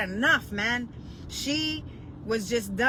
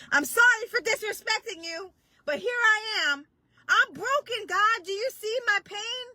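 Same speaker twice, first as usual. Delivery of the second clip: much higher, broken apologetic plea; crying to God; 10 semitones higher than usual. Retold or imitated. Preacher reenacting her broken prayer, high tearful plea. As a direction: plead through tears, voice breaking. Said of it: "Like, I cried to God".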